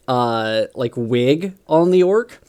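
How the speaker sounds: ordinary delivery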